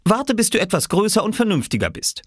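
a mother turning down her son kindly; Mama's voice. Acted. Mama refusing her son a fishing rod, gently firm. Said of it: "hatte Mama gesagt".

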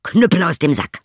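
high, loud command; called out. Acted. Turner catches the thief, shouting the command. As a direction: shout the cudgel into action. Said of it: "rief er".

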